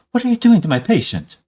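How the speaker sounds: high, loud outraged demand; indignant doctor. Acted. Doctor catches stranger handling his patient, loud and outraged. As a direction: snap at the intruder to stop. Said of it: "looks up ... and says".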